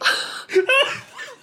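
laughing. Real, not acted. Laughs after sign-off.

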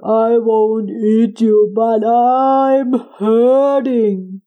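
slow, pained giant voice. Acted. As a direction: drag it out like a hurting giant.

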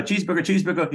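high, rapid-fire order-caller imitation; SNL diner cook. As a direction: call out the order like a sketch-comedy cook. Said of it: "like, right at S N L".

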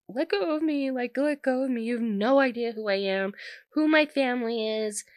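high mock-pleading whine; pathetic. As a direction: imitate someone helplessly begging to be released. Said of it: "being pathetic and is like".

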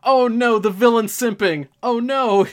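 high, loud mock-horrified cry; mock dismay. Host reacting to fans swooning over the villain, mock dismay. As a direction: wail it in playful alarm.

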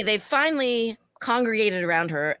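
ordinary talk, nothing marked

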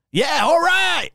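high, loud mock hype shout; obnoxious ride operator. Ride worker hyping riders, obnoxious shout. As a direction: imitate a carny obnoxiously revving up the crowd. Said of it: "you just gotta be real obnoxious".

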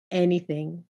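sly questioning echo with a rise; coy. A narrator's reading. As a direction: tease the word back knowingly. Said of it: "He smiled coyly".

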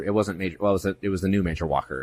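ordinary talk

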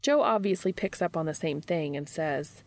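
ordinary delivery

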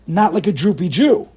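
rousing, booming reproach; emphatic exhortation. Real, not acted. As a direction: exhort the crowd forcefully. Exhorting listeners to say it strongly.

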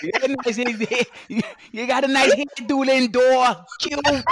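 high-pitched mock Boston heckle; hammed-up Boston fan, voice way up; Boston accent. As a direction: tease in a loud Boston accent. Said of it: "you had the Boston accent".

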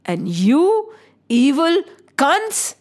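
slow, portentous prophecy of doom; theatrical, emphatic deity's threat; commanding goddess. Acted. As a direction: deliver a stern divine warning. Voices goddess warning the king.

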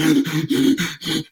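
huffing gasp for air; imitated gasping. Huffing up the ice fall behind the Sherpa, mock gasping. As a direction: suck air like a spent climber. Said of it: "And I'm like".

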